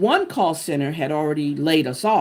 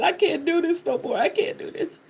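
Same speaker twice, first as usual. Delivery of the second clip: crying; 11 semitones higher than usual. Retold or imitated. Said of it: "I was crying".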